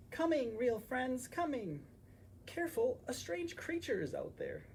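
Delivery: high, excited little hedgehog voice; eager hedgehog. Acted. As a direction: rush to the door, high and chirpy. Hedgehog answers door, warning.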